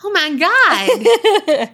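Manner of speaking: slow, high, laughing "oh my God"; drawn-out exasperated laugh. Real, not acted. Friend blurts the forbidden name, slow high laughing exclamation.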